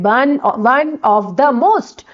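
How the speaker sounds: stretched out, word by word; very slow dictation. Shown as a demonstration. Teacher dictating the answer to students, very slow. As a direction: lay each word down slowly for them to copy. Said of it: "अब आपने क्या लगाना है superlative degree में?".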